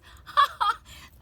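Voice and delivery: big high-pitched burst of laughter; real, loud laughter. Real, not acted. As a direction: crack up loudly. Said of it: "I'm literally laughing because".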